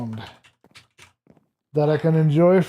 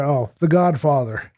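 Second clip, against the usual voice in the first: ordinary talk, nothing marked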